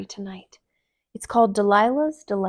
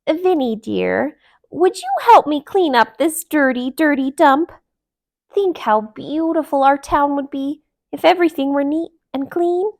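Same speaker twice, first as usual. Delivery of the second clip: sweetly; 6 semitones higher, 7 dB louder than usual. A narrator's reading. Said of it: "smiled her very best smile".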